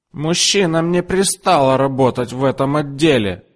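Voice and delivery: high mock outrage; shrieking customer. A narrator's reading. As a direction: scold the man away. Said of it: "закричала она". (высокий возмущённый крик клиентки)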